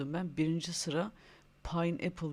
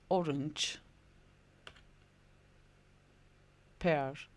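each word called out clearly, pausing for repeats; word list drill. Shown as a demonstration. Teacher calling out fruit words for kids to repeat, clear and paced. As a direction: drill the list, leaving gaps for echo. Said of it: "ben söylüyorum, sen dikkatlice tekrar".

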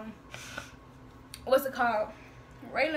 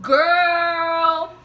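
loud, higher, drawn-out imitation; exclaimed girl; 4 semitones higher, 9 dB louder than usual. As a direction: stretch it out in a mock holler. Acting out friend's exclamation in comments.

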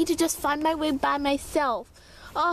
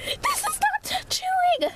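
whiny complaint; slower than usual. Acted. Complaining in the cold.

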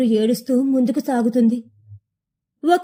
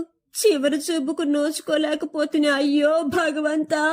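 weeping lament; 3 semitones higher than usual. A narrator's reading. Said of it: "అంటూ ఏడుస్తూ".

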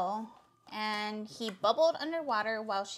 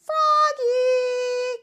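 long, high, loud holler; calling out; 11 semitones higher, 9 dB louder than usual. A narrator's reading. Mother calls Froggy in to swim, drawn-out loud call. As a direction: call the name out across the pond. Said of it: "caught his mother".

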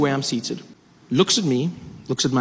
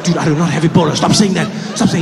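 louder, fed-up denial; exasperated protest; 7 dB louder than usual. Retold or imitated. [name] telling the accusing passenger to stop, loud exasperated. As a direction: shut him down, annoyed. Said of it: "I was like".

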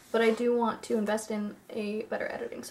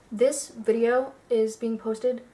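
normal talking voice

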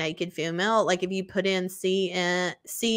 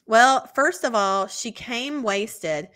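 slow dramatic reveal; much slower than usual. Acted. Dramatic reveal reading email.

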